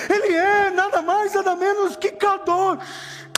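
high, sustained preacher's cry, drawing out the ends of phrases; full-force preaching. Real, not acted. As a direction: proclaim it with mounting fervour, hammering each phrase. Preaching builds to repeated Kadosh. (pregação exaltada, a plenos pulmões)

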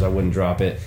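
ordinary delivery

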